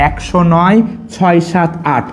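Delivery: slow একশো নয় ছয় সাত আট with pause after নয়; teacher models একশো নয় ছয় সাত আট slowly; chunked number recital. Shown as a demonstration. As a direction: mnemonic chant, slow. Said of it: "সবাই এভাবে উচ্চারণ করো".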